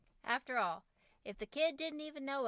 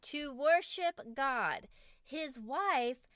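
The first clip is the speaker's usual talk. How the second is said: louder and slow, reading over the noise; raised voice; 3 semitones higher, 5 dB louder, much slower than usual. Retold or imitated. Daughter reading over her brother's wailing: raised, slow voice. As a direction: push the words out slowly to drown him out. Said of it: "She raised her voice".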